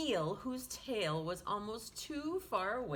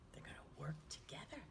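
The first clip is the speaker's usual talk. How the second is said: put-on hushed whisper to the kids; 6 semitones lower, 12 dB quieter, much faster than usual. Teacher pointing out the jellyfish to children, hushed whisper. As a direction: whisper it like sharing a secret.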